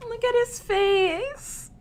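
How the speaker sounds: high, drawn-out adoring coo. Real, not acted. Reader melting over a frog's face, cooing. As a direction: coo slowly over something cute.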